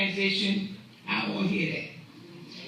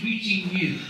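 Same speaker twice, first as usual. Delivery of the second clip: ordinary talk, nothing marked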